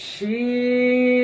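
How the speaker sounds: long sustained শিন, open sh sound; Arabic letter name. Shown as a demonstration. Teacher models শিন for students, slow recitation. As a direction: stretch শিন, teeth apart. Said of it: "আয়না দেখে দেখে বলুন".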